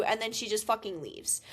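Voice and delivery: ordinary talk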